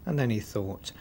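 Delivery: ordinary delivery, nothing marked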